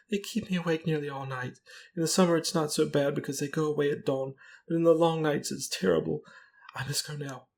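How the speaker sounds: high-pitched, plaintive misery; tearful distress. A narrator's reading. Companion describing her terrible nights, tearful distress. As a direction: pour it out high, on the edge of sobbing. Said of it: "she sobbed".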